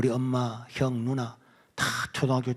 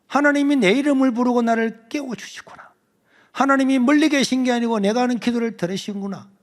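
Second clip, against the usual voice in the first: louder, higher, moved; overwhelmed realization; 9 semitones higher, 7 dB louder than usual. Retold or imitated. Boy realizing God hears him, moved and loud. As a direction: cry it out in awe.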